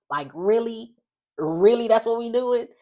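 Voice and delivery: high, loud disbelieving really; calling her out, exasperated and loud; incredulous retort. Retold or imitated. Speaker's own retort to Leslie.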